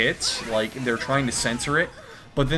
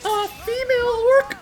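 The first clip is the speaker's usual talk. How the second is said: squeaky damsel scheming, much higher; high cartoon heroine voice; high girly heroine voice; 3 dB louder than usual. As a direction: play the plucky captive girl.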